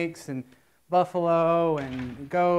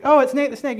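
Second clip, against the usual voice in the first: higher, louder startled recognition; surprised trucker; 6 semitones higher, 7 dB louder than usual. Retold or imitated. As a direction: blurt it out as a shocked trucker.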